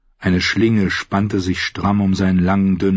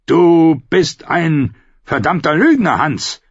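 higher, louder slow jeer; mock him as a liar; bearded outlaw jeering; rough outlaw, scornful; 9 semitones higher, 5 dB louder, slower than usual.

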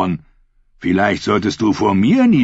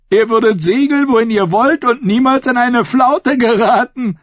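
very high, eager character voice; fawning follower; 5 dB louder than usual. A narrator's reading. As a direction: flatter the captain, pitch held high. Eager follower flattering his captain. (eifriger Gefolgsmann, sehr hohe Stimme)

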